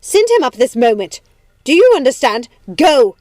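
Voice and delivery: building to a full loud shout; high, furious, imperious yelling; screamed order. A narrator's reading. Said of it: "I screamed".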